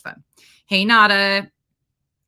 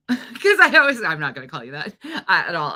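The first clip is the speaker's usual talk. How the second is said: laughing; 7 dB louder than usual. Real, not acted. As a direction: giggle while owning up to a joke. Teasing viewer about name.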